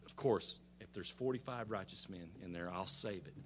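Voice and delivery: low, soft, easy agreement; patient Lord answering. Retold or imitated. The Lord agreeing to spare the city, low and patient. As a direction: grant it quietly, pitched low. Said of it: "And he says".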